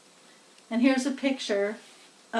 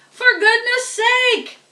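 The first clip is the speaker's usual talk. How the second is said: much higher, loud exasperated bellow; 12 semitones higher, 9 dB louder than usual. A narrator's reading. Soaked farmer scolds the hired man, angry bellow. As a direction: blast it out, drenched. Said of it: "bellowed Mister Zuckerman".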